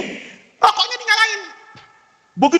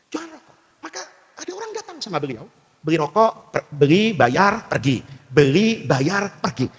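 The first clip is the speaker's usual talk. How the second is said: normal talking voice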